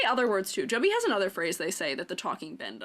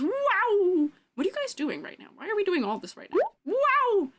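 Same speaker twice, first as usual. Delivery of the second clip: surprised; 10 semitones higher than usual. Real, not acted. Reacting to sudden chat flood.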